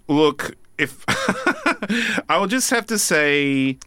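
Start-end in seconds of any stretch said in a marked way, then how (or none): 1.1-2.2 high burst of laughter; sheepish high laugh. Real, not acted. Laughs before choosing winner.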